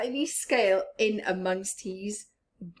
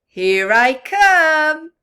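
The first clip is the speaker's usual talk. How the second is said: slow, much louder happy call; joyful reply; 6 semitones higher, 13 dB louder, slower than usual. Acted. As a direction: sing it out as you swim off. Rainbow Fish answers friends.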